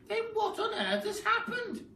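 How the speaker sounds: very high panicky cry; crying Mrs Twit. Acted. Wife crying out in a high panicky voice. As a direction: wail it in a high crone voice. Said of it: "cried Missus Twit".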